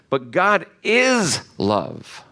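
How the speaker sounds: slow, with "is" drawn out and punched; stress on IS. Real, not acted. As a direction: hold and hammer the word "is". Contrasts being loving with being love.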